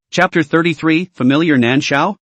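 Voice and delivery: ordinary delivery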